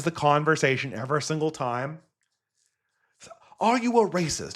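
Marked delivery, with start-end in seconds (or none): 3.6-4.6 higher-pitched imitation of an interviewer; put-on scandalised interrogator; mock accusing question. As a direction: pounce on the question in mock alarm. Acts out Piers challenging Nick.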